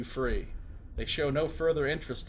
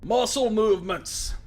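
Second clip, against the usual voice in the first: loud, raised voice of a wounded prisoner; gruff Mazov; 8 semitones higher, 7 dB louder than usual. Acted. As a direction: bark it at the intruder in surprise.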